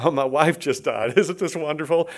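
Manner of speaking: falsely bright, laughing through grief; hammed-up happy mourner, chuckling; fake cheerful. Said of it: "put on a smiley face".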